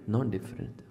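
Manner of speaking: nearly breathed, fading away; soft echo. Acted. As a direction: let the repeat sink in softly.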